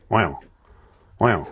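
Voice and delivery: a low, drawn-out [name] "Wow". Shown as a demonstration. Cellmate drilling the [name] sound, low wow. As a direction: croon "Wow" low like the King. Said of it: "the first sound was".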